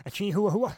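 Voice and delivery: "chihuahua" stretched to chee-hoo-a-hoo-a; playful mispronunciation. Pretend. Echoing co-host's dog idea, "chihuahua" playfully mangled as chee-hoo-a-hoo-a.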